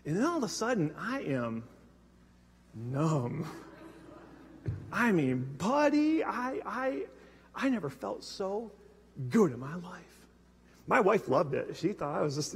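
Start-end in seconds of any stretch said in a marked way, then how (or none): 1.0-10.0 soft, slow, dazed voice; quietly spaced-out confession; numb hush. Retold or imitated. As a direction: let it drain out, flat and hushed. Describing feeling numb on medication.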